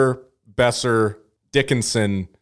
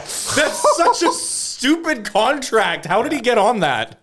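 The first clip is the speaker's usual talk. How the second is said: high-pitched, drawn-out incredulity; amazed disbelief; 12 semitones higher, slower than usual. Real, not acted. Host reacting to a cheap contract, amazed disbelief. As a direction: marvel slowly, voice pitched way up.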